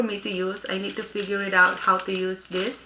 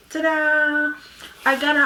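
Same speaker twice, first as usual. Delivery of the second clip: excited; 5 semitones higher than usual. Real, not acted. Reveals the box of aligners.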